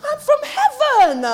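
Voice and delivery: high, loud declaration, building to a forceful cry; vehement. Real, not acted. Preacher breaking unbelief off the congregation, vehement. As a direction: proclaim it with full preaching force. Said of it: "That was vehement".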